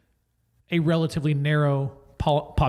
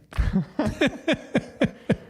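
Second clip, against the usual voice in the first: high, rising giggle; high-pitched run of laughter; laughing; 8 semitones higher than usual. Real, not acted. Host laughs at own joke about secrets, high giggle.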